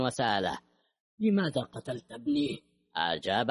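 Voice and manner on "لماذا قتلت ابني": higher-pitched, pained accusing question; accusing demand. A narrator's reading. Father holds son's killer at gunpoint, accusing.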